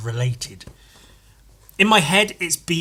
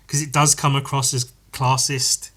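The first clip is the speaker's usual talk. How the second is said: ordinary talk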